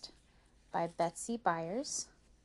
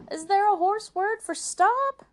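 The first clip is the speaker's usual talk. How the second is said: frantic question, high and loud; alarmed cry; 14 semitones higher, 10 dB louder than usual. Acted. May May needing the horse stopped, frantic cry. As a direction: yell the question in a panic. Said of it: "cried May May".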